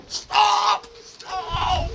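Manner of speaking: high, urgent mock shout of the Aussies; shouted warning. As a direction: yell at them to stop, imitating the locals. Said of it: "All the Aussies are like".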